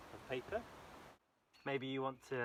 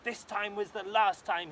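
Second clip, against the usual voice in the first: loud, higher king's declaration; the king vows it is over; angry king; 7 semitones higher, 10 dB louder, slower than usual. Acted. King pacing his courtyard, loud higher vow. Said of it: "he said".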